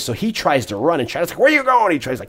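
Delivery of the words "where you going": high, loud call through a storm; shouting after him. Retold or imitated. Scheider shouts after the hitman running off in the rain, loud and high. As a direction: yell after the fleeing hitman.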